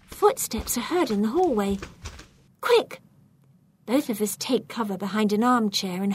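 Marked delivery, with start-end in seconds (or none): 2.6-3.0 sudden high-pitched urgent cry; urgent alarm. A narrator's reading. Burglar warning partner as footsteps approach, urgent. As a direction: warn a partner to hide fast. Footsteps heard, must hide.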